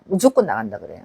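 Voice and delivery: quiet low echo of the line; low quiet aside. Real, not acted. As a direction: drop the voice to a low murmur.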